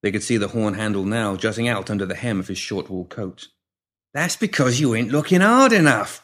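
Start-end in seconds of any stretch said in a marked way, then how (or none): 4.1-6.2 growled. A narrator's reading. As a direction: growl it out at her. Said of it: "Pinky growled".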